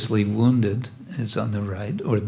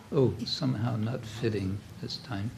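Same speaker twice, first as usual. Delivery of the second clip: usual speaking voice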